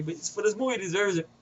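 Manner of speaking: ordinary talk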